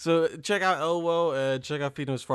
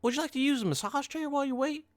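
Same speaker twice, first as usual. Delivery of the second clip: high put-on voice of the lady; imitating the trainer; 13 semitones higher than usual. The new-job trainer offering a massage chair, high mocking voice. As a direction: mimic her sweet offer in a much higher voice. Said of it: "and said".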